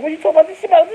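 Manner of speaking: very high, loud shriek; mock shrieking girlfriend. Imitating the girlfriend shrieking at her man. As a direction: screech it back at him. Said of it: "She's like".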